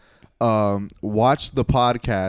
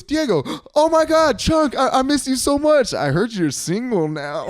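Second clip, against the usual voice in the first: high, louder gushing imitation; Carlos; 4 dB louder than usual. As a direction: mimic an over-excited friend, easing down at the end. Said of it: "First he goes".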